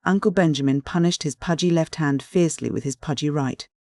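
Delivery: usual speaking voice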